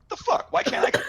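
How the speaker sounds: high, incredulous outburst; exasperated high squeak. Retold or imitated. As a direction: squeak it in disbelief. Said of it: "Balin's like".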